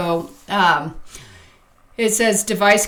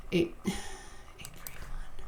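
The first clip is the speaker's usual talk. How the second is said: whispering to self; 13 dB quieter than usual. Real, not acted. Reading number under breath.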